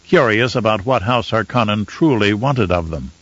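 ordinary delivery, nothing marked

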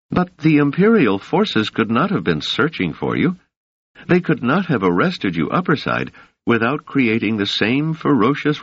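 a hint of the young woman, measured and soothing; Doris voice.

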